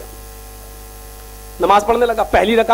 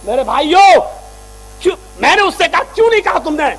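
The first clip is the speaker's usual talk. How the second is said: preaching; 3 semitones higher, 5 dB louder than usual. Acted. Preacher addressing his brothers, rising preaching. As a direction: plead with the crowd.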